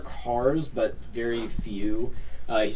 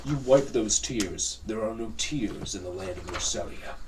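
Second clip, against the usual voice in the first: slow, measured command; cold authoritarian decree; stern captain; 4 semitones lower, 4 dB quieter than usual. Acted. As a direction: lay down the law without raising the voice. Captain sternly orders crying children.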